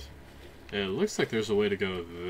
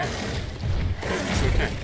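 slow, breathy hush to calm him; gentle slow whispered reassurance; soothing whisper; 4 dB louder, much slower than usual. Real, not acted. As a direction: whisper it like calming a scared animal. Calming the frightened creature.

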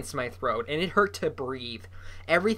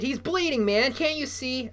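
high-pitched agitated outburst; agitated protest; 10 semitones higher than usual. A narrator's reading. Bully protests that his friend is hurt, agitated.

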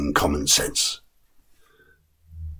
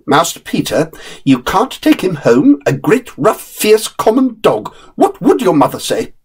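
higher, scolding nurse's voice; objecting nurse; 9 semitones higher than usual. Acted. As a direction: talk the boy out of it, disapproving. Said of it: "had to make objections".

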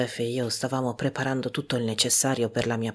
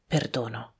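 moved; 2 semitones lower, 4 dB quieter than usual. A narrator's reading. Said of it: "tutto commosso le dissi".

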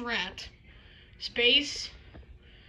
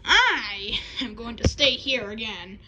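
usual speaking voice

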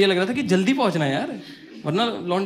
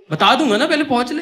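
high, loud exasperated snap; friend's hurried snap; 7 semitones higher, 8 dB louder than usual. Retold or imitated. Friend cuts him off while they run, loud and high. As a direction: brush him off, just run first.

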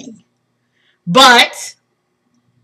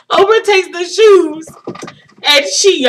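higher voice, on the edge of crying; tearful; 7 semitones higher, 4 dB louder than usual. Real, not acted. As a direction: push on through happy tears. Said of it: "I'm gonna start crying again".

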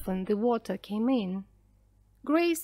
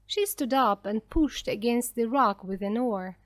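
usual speaking voice

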